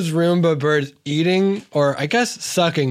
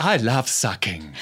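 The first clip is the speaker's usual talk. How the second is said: low, soft and creepy; 8 semitones lower, 7 dB quieter than usual. Acted.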